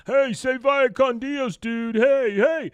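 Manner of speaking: bang-on-the-glass heckling; high loud heckle; mock yelling kids.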